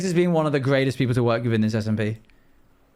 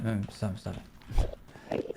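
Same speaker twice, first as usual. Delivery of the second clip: whispered plea; 6 semitones lower, 11 dB quieter, much slower than usual. Real, not acted. Tense moment in game.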